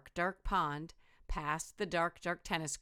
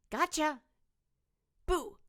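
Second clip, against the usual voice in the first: much higher playful boo; mock scare; 10 semitones higher, 4 dB louder than usual. Little skeleton frightens the big one, high and playful. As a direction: jump out and shout boo.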